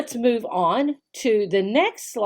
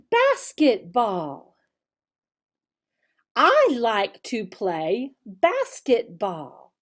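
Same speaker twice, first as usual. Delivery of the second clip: slow, exaggerated teacher voice, brighter on questions; slow teacher modeling. Shown as a demonstration. Teacher modeling the lesson for children, slow and clear. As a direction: model each sentence slowly and clearly for little kids. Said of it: "See, simple".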